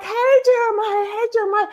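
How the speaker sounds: very high, sing-song repeated greeting; sugary high voice, greeting the boy over and over; mocking sweet neighbor. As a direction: ham up her fake-friendly hello. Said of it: "she'd be like".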